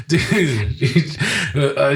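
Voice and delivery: high, real laugh spilling into the word; high disbelieving laugh, then Dude; burst of laughter. Real, not acted. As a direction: crack up in disbelief at the story. Stunned by the slap story.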